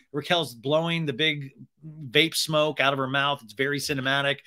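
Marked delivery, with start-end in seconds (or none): none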